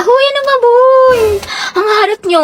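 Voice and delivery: put-on high, loud whining kid voice; whiny kid. Classmate whining loudly at noisy classmates. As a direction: whine it high like a pestered child.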